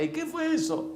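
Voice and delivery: high-pitched mock mom; mamá alarmada. Mamá voceada en el relato. (mamá alarmada, imitada en tono agudo)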